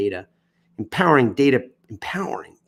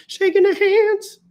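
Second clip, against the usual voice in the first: squeaky; 6 dB louder, slower than usual. Acted.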